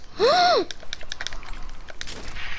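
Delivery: sudden startled intake of breath; sharp gasp. Real, not acted. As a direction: gasp in shock. Startled in game.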